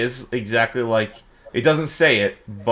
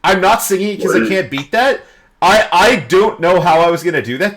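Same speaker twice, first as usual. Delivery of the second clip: amazed; 7 semitones higher, 5 dB louder than usual. Real, not acted. Amazed by the song.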